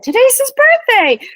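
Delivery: sudden amazed realization; surprised. Retold or imitated. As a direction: realise it with a gasp of wonder. Excited realization.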